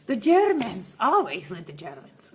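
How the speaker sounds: playful outburst; loud teasing complaint; mock exasperated. Joking about German fans.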